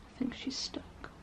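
very soft whisper; hushed aside. Real, not acted. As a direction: whisper it almost to yourself. Aside watching the stuck dog.

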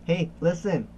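building from plain to loud, high "Hey"; mock pestering sidekick, rising to a shout; nagging compass voice. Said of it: "It's like".

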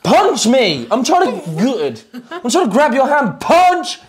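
high, insistent commands; mock exasperated coaching. Coach training friend to fend off men, mock exasperated. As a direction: urge it with exaggerated frustration.

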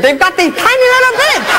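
comically tiny, high-pitched delivery. Acted. Deaf comedian jokes about birds' tiny mouths, high squeaky voice.

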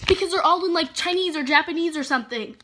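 ordinary talk

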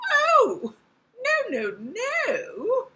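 very high, loud little fly; wary female fly. Acted. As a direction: squeal the refusal up high. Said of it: "I want the fly to be female".

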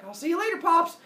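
high, loud call-back; gleeful farewell. Acted. Son escaping with the keys, calling out gleefully. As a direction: toss it back as you run off.

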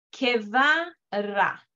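"Que va ra" read slowly, syllable by syllable. Shown as a demonstration. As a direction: sound out each syllable slowly. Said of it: "como que fuera una sola sílaba".

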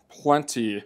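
ordinary delivery

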